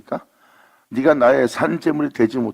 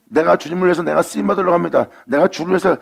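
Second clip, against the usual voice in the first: a lightly voiced eager believer, higher; zealous believer; 6 semitones higher than usual. Preacher mimics a believer telling God of his labor, eager. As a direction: earnestly boast of your service.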